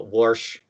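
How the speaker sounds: "wash" said as "warsh"; intrusive R. Shown as a demonstration. Explaining Midwest quirks, "wash" pronounced "warsh". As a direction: slowly put an R into "wash". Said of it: "suddenly add an R into that".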